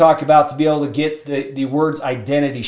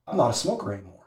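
low, soft statement; quiet acceptance; 6 semitones lower, 10 dB quieter, much faster than usual. Retold or imitated. Smoker quenched after the ordeal, quiet acceptance. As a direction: say it subdued, under your breath.

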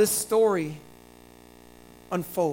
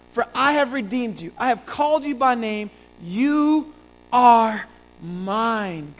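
loud, raised, emphatic proclamation; declaimed scripture; 3 semitones higher, 5 dB louder, slower than usual. Acted. Preacher declaring God's promise from Isaiah, loud and emphatic. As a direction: proclaim it forcefully, stretching the last words.

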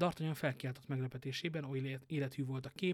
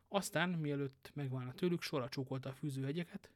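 plain reading voice

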